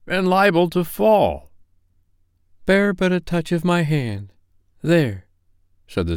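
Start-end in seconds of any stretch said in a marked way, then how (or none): 0.1-1.5 higher, pleading protest; remonstrating old Scrooge. Acted. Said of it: "Scrooge remonstrated". 2.6-5.3 higher, solemn ghostly warning; ghost of Christmas past. Acted. Ghost reassures Scrooge.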